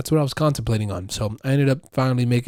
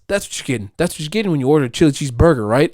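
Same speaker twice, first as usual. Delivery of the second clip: ordinary delivery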